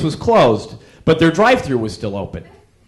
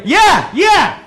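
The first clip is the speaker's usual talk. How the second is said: loud and much higher, fed up; annoyed shout; 13 semitones higher, 4 dB louder than usual. Retold or imitated. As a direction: bark it back at them. Fed up with muffler remarks.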